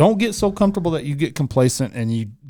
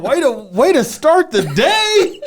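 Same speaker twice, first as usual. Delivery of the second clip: high, drawn-out laughing groan; slow, high, hammed-up reaction; laughing mock dismay; 10 semitones higher, 5 dB louder, much slower than usual. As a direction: laugh through exaggerated exasperation. Laughing imagined morning reaction.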